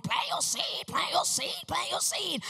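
high, far-off imitation of a TV preacher. Telling Ken about the TV guy, imitating his high sing-song plea. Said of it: "the guy came on and went".

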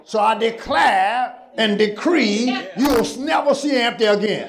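high, ringing shouted decree; prophetic declaration. Acted. Declaring over congregation.

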